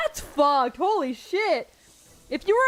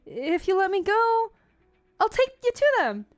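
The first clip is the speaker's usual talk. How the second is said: pleading captive; 3 semitones higher than usual. Acted. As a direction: bargain desperately for your freedom.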